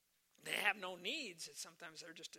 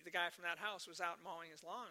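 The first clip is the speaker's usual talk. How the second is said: ordinary talk, nothing marked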